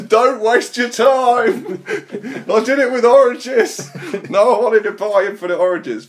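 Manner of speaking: high, loud, drawn-out cry; hammed-up shouted warning. Del Monte man appears to warn them, high hammed-up shouting. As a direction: wail it slowly at full volume.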